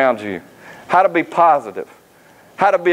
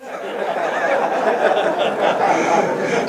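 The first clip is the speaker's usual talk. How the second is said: laugh; 6 semitones higher, 4 dB quieter than usual. Real, not acted. Laughing at dad's joke.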